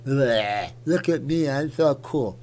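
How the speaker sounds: goofy put-on showing-off voice; mocking imitation. Streamer mocking a celebrity who licked a donut, goofy put-on voice. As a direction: mimic a silly show-off, tongue-out "bleh" first.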